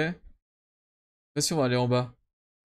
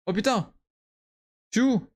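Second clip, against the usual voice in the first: startled; 7 semitones higher than usual. Real, not acted. Sudden startle in game.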